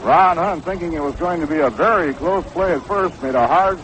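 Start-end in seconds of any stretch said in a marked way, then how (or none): none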